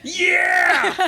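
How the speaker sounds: high, loud laughing shout; excited yell. Real, not acted. Cohost reacting to her own book note, excited laughing yell. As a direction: burst out with it, laughing.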